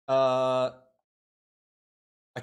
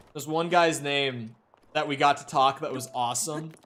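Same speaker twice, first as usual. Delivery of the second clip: normal talking voice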